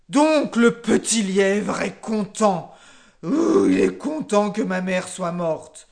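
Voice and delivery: loud, slow royal fury; furious lion roar. A narrator's reading. The furious lion king orders the hare brought, loudly roaring. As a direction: roar orders to seize him. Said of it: "Le lion devint encore plus furieux. Il rugit".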